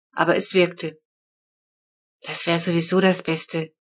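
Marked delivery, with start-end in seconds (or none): none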